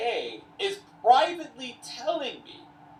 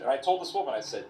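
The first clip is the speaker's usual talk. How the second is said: plain reading voice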